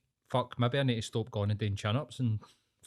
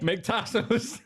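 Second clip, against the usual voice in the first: laughing; 10 semitones higher than usual. Real, not acted. Laughing at the sponsor name.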